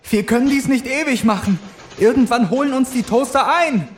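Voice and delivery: breathless Clara. Acted. Clara running from the toasters, high and urgent. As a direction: cry it out with rising panic.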